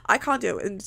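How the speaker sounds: ordinary delivery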